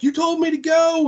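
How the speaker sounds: high, loud mock yell; hammed-up high accusing yell; retold yelling. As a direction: shout the blame back at him. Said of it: "yelling at each other".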